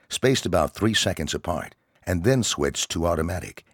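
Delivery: ordinary delivery, nothing marked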